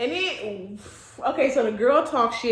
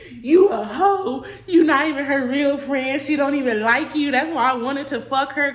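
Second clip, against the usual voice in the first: loud retold tirade of insults; mock angry yelling; 3 semitones higher, 7 dB louder than usual. Boyfriend insulting her after seeing the picture, loud mock tirade. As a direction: act out him going off, loud and hard.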